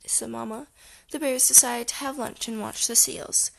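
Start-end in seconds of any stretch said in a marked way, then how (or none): none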